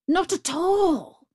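high, slow, emphatic denial; swearing earnestly. A narrator's reading. Caged tiger reassuring the doubtful Brahmin, swearing earnestly. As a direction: protest it with a soaring, drawn-out oath. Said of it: "swore the tiger with many oaths".

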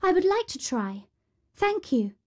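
put-on high, sweet girl's voice; polite Cinderella. A narrator's reading. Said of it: "said Cinderella".

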